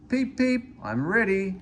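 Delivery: high, bright peep of a small engine; little engine whistle. A narrator's reading. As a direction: chirp like an eager small engine.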